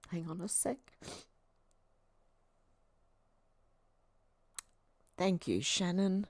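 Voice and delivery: soft, crying half-whisper; real tears, barely getting it out; whispering thanks through tears; teary whisper. Real, not acted. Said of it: "The waterworks are on".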